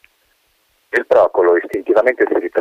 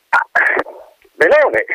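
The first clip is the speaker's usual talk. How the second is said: pleased colonel. Acted.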